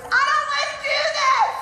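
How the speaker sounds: a full temper tantrum yell; shout. Retold or imitated. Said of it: "I shouted again at the top of my voice".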